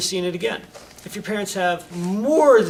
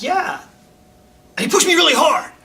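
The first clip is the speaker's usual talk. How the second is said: much higher and louder, whiny protest; complaining kid; 11 semitones higher, 9 dB louder than usual. Retold or imitated. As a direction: wail it to mom like a wronged kid.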